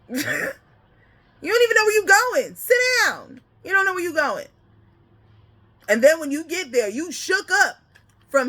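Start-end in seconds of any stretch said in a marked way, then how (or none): 0.1-4.5 sit them down, teasing; mock scolding. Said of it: "And he's like".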